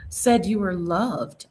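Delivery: ordinary delivery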